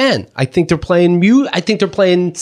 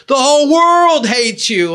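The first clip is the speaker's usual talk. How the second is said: high, slow, drawn-out proclamation; mock leader; 8 semitones higher, slower than usual. He mimics the leader escalating the hate, high drawn-out call. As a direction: stretch each word in a high taunting call.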